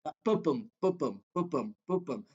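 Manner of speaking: ba-bum, ba-bum tapped out as a steady heartbeat, weak then strong; iambic beat. Shown as a demonstration. Teacher demonstrating the iambic heartbeat to students, steady da-DUM beat. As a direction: thump out ba-bum with the stress on bum each time. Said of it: "iambic rhythms are actually much slower".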